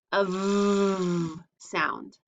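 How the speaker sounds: V held long with teeth on lip; V sound demo. Shown as a demonstration. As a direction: stretch the V slowly for the kids. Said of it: "See my mouth?".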